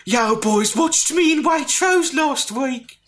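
high, slow flirty woman's voice; beautiful purring voice.

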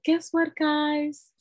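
high giddy call, lightly done; drugged giddy self. Retold or imitated. Calling her dad after the birth, giddy and high. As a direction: sing it out, loopy on meds. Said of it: "I was like".

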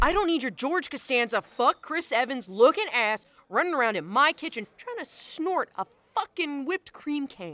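loud furious rant at the intruder; angry yelling. Acted. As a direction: chew him out, full volume. Chef yelling at guest in kitchen.

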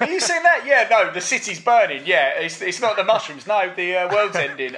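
high, loud mock panic about the burning city; hammed-up terrified tripper. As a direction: play it like the world is ending. Acting out apocalypse panic.

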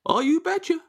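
very high put-on Fargo accent. Fargo guest answering the puzzled host, high Fargo accent. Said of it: "Oh, I'm from Fargo".

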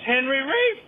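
high mock masseuse voice; masseuse's question. Masseuse repeating herself to the confused client, high mock voice. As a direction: ask it high, rising at the end. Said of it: "She goes".

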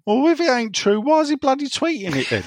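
high-pitched, incredulous retort; mock exasperated fan. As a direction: voice a baffled, annoyed fan, lightly. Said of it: "And people thinking".

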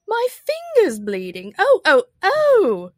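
loud, high shrieked wail; screaming Queen. A narrator's reading. The Queen cries over her bleeding finger, screaming. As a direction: scream like a steam-engine whistle. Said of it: "Her screams were so much like the whistle of a steam engine".

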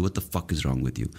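ordinary delivery

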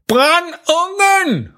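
dad yelling "Brænd ungen" very slow and high; shouting at full voice. Acted. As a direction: bellow it slowly across the hall. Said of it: "råber far nu for fuld hals".

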